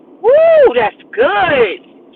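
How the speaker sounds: sudden high loud whoop of delight; excited whoop. Real, not acted. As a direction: cry out, thrilled by the word. Excited shout at own line.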